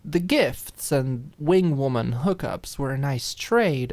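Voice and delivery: usual speaking voice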